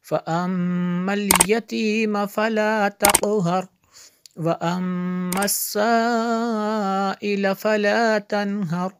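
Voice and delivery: connected, melodic Quran chant with long madds; hadr recitation. Shown as a demonstration. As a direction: sing the verses through in flowing recitation. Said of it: "Haider में देख लेते हैं". (हदर में रवाँ, सुरीली तिलावत)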